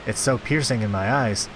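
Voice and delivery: ordinary delivery, nothing marked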